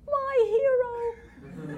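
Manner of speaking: very high, slow, laughing swoon; swooning damsel. Pretend. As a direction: sigh it out like a rescued heroine. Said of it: "this childish parody of melodrama".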